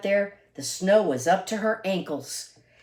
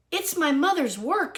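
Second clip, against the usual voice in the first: mild mock scream; 8 semitones higher than usual. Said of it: "she screamed".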